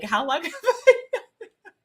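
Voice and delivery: high, laughing through the question; laughing disbelief. Retold or imitated. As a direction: giggle in amazement mid-question. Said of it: "And I was like".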